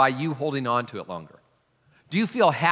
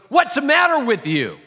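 much higher, much louder furious yell; old man furious; 11 semitones higher, 8 dB louder than usual. Retold or imitated. Old man screaming at a shopper who brushed past, furious yell. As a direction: blow up at him like an enraged old man. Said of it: "The old man goes ballistic".

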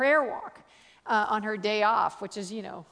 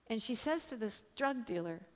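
ordinary talk

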